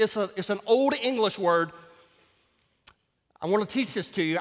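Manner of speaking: ordinary delivery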